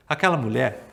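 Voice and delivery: plain reading voice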